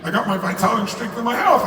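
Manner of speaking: ordinary delivery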